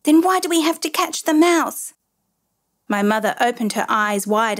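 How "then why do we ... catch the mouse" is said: high-pitched triumphant challenge. A narrator's reading. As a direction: press the point home in a raised pitch. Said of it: "I shouted triumphantly".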